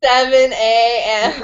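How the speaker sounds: slow, stretched-out mimicking voice; mock smooth-singer drawl; playful echo. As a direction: mimic it back, very drawn out. Playfully echoing guest's put-on voice.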